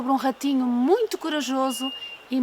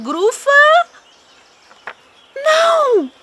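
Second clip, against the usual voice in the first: shocked gasp; 12 semitones higher, 6 dB louder, much slower than usual. Acted. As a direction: cut off with a shrieking no. Mouse sees the monster.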